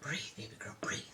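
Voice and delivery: soft unvoiced whisper to herself; whispered self-calming. Real, not acted. Grandma calming herself mid-lesson, whispered. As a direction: breathe it out, calming down. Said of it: "I'm a little excited".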